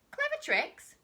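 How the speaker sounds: very high, loud squeal of disbelief; small croc cries out in disbelief; incredulous cry. Acted. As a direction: shriek it back in mocking surprise. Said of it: "cried the not so big one".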